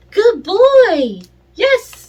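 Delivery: high, loud happy praise; excited praise. Shown as a demonstration. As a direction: make a big fuss of the dog. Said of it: "make a big deal of it".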